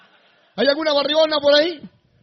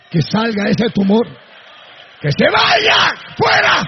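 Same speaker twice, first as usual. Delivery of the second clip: commanding shout; 6 dB louder, slower than usual. Real, not acted. Preacher ordering a tumor out, shouting. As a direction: cast it out with a full-force yell.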